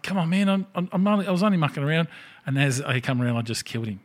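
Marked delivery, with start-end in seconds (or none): 0.0-2.1 put-on high, pleading young gamer voice; a high, pleading imitation of a young gamer. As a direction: beg him to spare you. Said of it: "and he's like".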